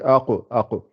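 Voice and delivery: aqo with a full, heavy bouncing q; full heavy qaf. Shown as a demonstration. Teacher demonstrates the heavy aqo. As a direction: aqo puffed up, the q tapped out. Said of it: "Elle est gonflée".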